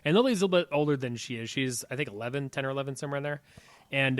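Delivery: ordinary delivery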